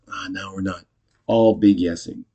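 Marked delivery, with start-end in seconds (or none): 0.1-0.9 very soft sighing echo; hushed, conceding echo; acted soft resignation. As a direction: let it drop away like a sigh.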